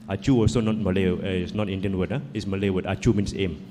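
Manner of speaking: ordinary delivery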